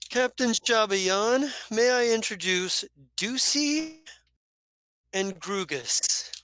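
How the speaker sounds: high, slow, showy announcement; grand introduction. Acted. Zaridan introducing two companions to the captain, grand announcing voice. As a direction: present them with a flourish, drawing out the names.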